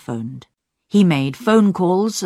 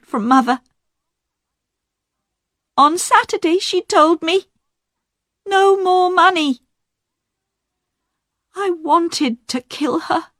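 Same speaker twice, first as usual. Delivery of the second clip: high, strained confession; voiced daughter; 11 semitones higher than usual. Diane to the inspector, high tearful admission. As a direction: admit it all with the voice still tight from crying.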